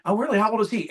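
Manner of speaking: lightly acted friendly surprise; keen interest. Agent asking client about the grandchild, keenly interested.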